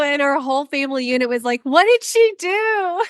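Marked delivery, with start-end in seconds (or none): 1.6-3.0 high, slow chorus of shock; comically aghast, drawn out; mock disbelief. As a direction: stretch it out high like scandalized relatives. Family's shocked reaction retold.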